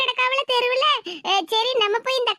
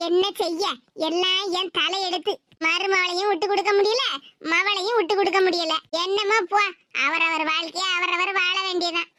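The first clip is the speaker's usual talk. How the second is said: put-on resigned old mother; 5 semitones lower than usual.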